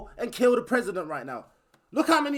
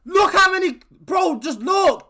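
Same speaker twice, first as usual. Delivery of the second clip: angry shout; 4 semitones higher, 4 dB louder, slower than usual. Real, not acted. Angry outburst at police.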